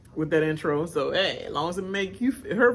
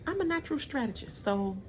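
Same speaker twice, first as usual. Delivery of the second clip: imitating Danielle; 10 semitones higher, 5 dB quieter than usual. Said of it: "She's like".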